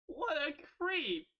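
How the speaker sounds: mock outrage. Real, not acted.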